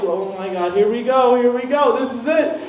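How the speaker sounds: acted alarm, repeating it with rising urgency; mock panic. Boyfriend bracing as his girlfriend explodes, mock panic.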